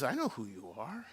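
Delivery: low, soft, rising at the end; quiet knowing. Acted. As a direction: say it gently and low, left open. Jesus answers Peter, first take.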